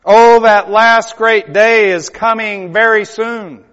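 slow, loud, high cry; thundering pulpit proclamation; proclaiming. Acted. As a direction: ring out the warning. Climactic warning.

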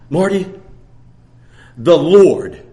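slow, deliberate, low and loud; a hint of the sister's slow solemn telling; sister's exact words. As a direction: lean into the greatest slowly. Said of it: "this is the way she said it".

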